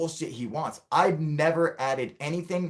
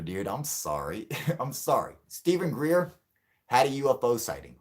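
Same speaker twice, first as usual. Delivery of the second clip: usual speaking voice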